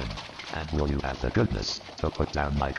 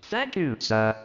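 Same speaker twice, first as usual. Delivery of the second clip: much higher, stammering disbelief; stunned clerk; 10 semitones higher, slower than usual. Acted. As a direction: voice Bob gaping at the news. Bob thanking.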